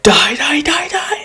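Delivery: very high mock battle scream. Bakugo charging at All Might, screaming. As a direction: screech it in rapid repeats. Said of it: "comes screaming".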